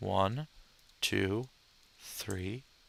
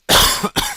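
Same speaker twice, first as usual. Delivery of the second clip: real loud cough; harsh unvoiced coughing burst; coughing fit; 11 dB louder than usual. Real, not acted. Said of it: "I'm coughing so much tonight".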